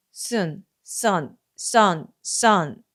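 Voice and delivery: 순 corrected to 선, repeated; model word. Shown as a demonstration. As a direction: move from 순 to 선 and hold it. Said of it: "태양, 해라는 뜻의". (순에서 선으로 고쳐 말하기)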